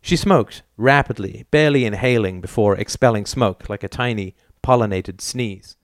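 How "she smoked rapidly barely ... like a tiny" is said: plain reading voice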